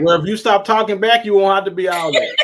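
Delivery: mock quarrel retort. Man playfully answering a woman's acted complaint, mock-indignant retort. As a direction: fire back loudly, playing the aggrieved partner. Acts the other side of a couple's quarrel.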